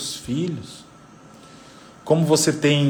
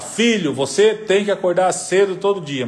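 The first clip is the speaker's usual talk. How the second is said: high-pitched, insistent parental instruction; lecturing parent; 6 semitones higher than usual. Acted. Parent telling son to get up early, insistent lecturing. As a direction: imitate a parent laying down a rule. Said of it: "Não é a fala".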